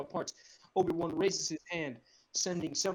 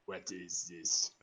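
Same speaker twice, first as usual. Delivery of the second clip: menacing Sidious; 9 semitones lower, 5 dB quieter, much slower than usual. Acted. Sidious hologram voice, slow.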